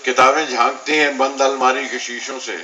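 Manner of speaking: measured verse in separated phrases; poetry recitation. Acted. Poet recites a poem on neglected books to listeners, poetry recitation. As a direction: lift each opening line, let the next fall away.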